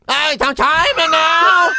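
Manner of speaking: high, loud, drawn-out mock Vietnamese; over-the-top shouted Vietnamese impression; mock Vietnamese accent. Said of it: "What, Vietnamese accent?".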